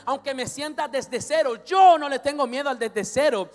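loud, high, mounting declaration of faith; full-force preaching. Real, not acted. Preacher declaring no fear of starting from zero, impassioned shouting. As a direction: belt it out with conviction, building.

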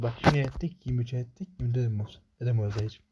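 ordinary delivery

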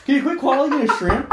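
a giggly retort, cracking up; laughing, mock-annoyed protest; laughing mock complaint. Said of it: "I was like".

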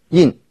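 Yin said in the fourth tone, falling sharply. Shown as a demonstration. Teacher demonstrates a Mandarin word for learners, "Yin" in falling fourth tone. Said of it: "se pronuncia en el cuarto tono".

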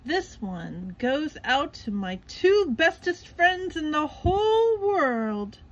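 slow, high, sloppy sentimental dedication; tipsy announcing. A narrator's reading. SpongeBob dedicating a song to friends, slow and gushing. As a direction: gush over your friends. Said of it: "SpongeBob announced".

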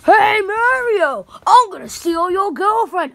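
loud, high taunting holler; taunting call-out. Acted. Character calling out to Mario, loud high taunt. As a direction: holler it over at a rival.

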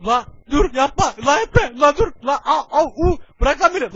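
high, loud, frantic pleas; panicked yelling. Real, not acted. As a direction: beg them to stop in panic. Player panics as fight erupts.

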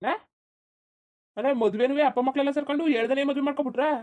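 high, loud, shocked scolding; loud indignant cry; shocked outburst. Acted. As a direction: grandmother shouting in disbelief at the news. Grandmother shocked by the marriage.

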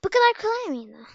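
indignant disbelief. Real, not acted. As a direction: protest how easy it was. Disbelief at easy route. (isyan eden şaşkınlık)

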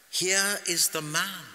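high, loud, slow announcement; ringing, stretched-out proclamation; proclaiming. A narrator's reading. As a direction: present him to a whole crowd. Pilate presents Jesus to the crowd.

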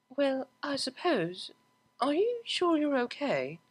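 unsure and concerned; Octavia. Acted. Octavia voiced.

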